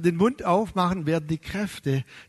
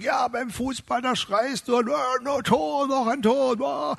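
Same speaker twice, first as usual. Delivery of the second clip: imitating father mocking; 5 semitones higher than usual. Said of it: "mein Vater hat gesagt".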